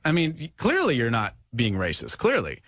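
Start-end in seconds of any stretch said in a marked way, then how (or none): none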